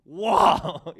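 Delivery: breathless, amazed "oh, wow" that bursts out loud; awed gasp. Retold or imitated. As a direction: imitate God struck with wonder, a touch hammed-up. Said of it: "he'd be like".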